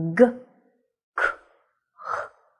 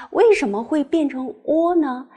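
usual speaking voice

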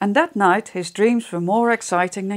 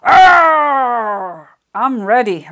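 loud, higher mock roar, then ready; a little mouse roaring to sound fierce; 4 semitones higher, 9 dB louder, much slower than usual. Mouse roars he is ready, loud playful mock roar. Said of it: "roared Fierce Grey Mouse".

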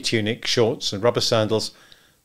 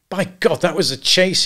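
high, exhilarated exclamation; wildly happy; 8 semitones higher, 4 dB louder than usual. A narrator's reading. Husband exults after the buffalo chase, wildly happy. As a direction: burst out with elation. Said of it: "Macumber felt a wild, unreasonable happiness".

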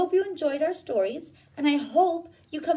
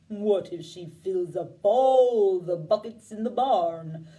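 low, slow, lumbering cow; lower, slow fretful cow; 6 semitones lower, 3 dB louder, slower than usual. Acted. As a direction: speak like a worried old cow, dragging each word. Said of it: "asked the cow".

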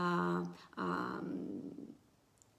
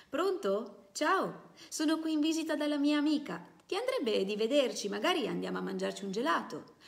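put-on higher and louder young woman on the phone, bright and sure; ringing, decisive voice; 7 semitones higher, 4 dB louder than usual. Girlfriend phoning the soldier at the barracks, ringing and decisive. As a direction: call him up with confident, ringing cheer. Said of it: "una voce squillante e decisa esclamò".

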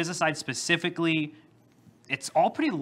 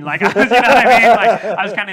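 loud, high, talking through laughter; laughing; 8 semitones higher, 17 dB louder than usual. Real, not acted. Speaker explaining an awkward sales pitch, laughing loudly. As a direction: crack up while explaining yourself. Laughing at his own retold reply.

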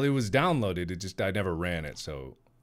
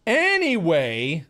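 drawn-out anyway; 8 semitones higher, 9 dB louder, much slower than usual. Pretend. Changing subject loudly.

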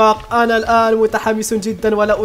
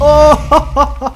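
long, loud, high laughing oh; a drawn-out delighted oh; amused surprised oh; 5 semitones higher, 5 dB louder, much slower than usual. Real, not acted. Explosion hits several monsters.

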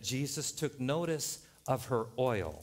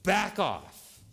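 raised, louder command with a sharp fall; warning to the enemy; 6 semitones higher, 5 dB louder than usual. Acted. God speaks to the enemy, forceful warning. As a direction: order the enemy away. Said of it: "he says to the enemy".

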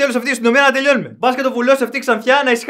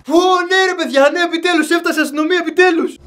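mock relief; 7 semitones higher than usual. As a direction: celebrate a long-awaited rescue, hammed-up. Mock relief as police arrive.

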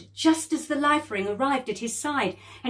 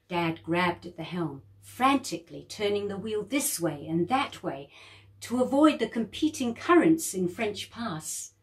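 ordinary delivery

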